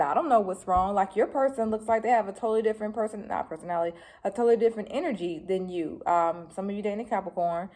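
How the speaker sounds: ordinary delivery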